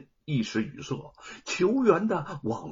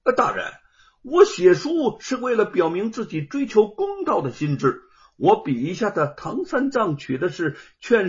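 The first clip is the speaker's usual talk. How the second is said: raised, clear voice, higher; ringing reply; 5 semitones higher, 4 dB louder than usual. Acted. Scholar answers the judge boldly, raised voice. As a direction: answer boldly, a bit louder. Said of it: "朗声答道".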